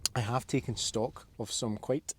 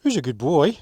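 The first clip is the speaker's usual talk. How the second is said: much louder, excited doggy praise; 10 dB louder than usual. Real, not acted. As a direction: pump it up for the wagging dog. Praising the dog again.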